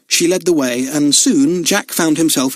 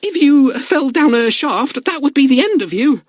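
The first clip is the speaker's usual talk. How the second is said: rather shaky; 13 semitones higher than usual. A narrator's reading. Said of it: "her voice rather shaky".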